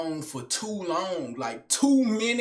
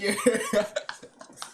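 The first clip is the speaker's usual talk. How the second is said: real burst of giggly laughter; laughing. Real, not acted. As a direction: crack up at your own bit. Cracks up at his act.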